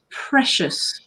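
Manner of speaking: usual speaking voice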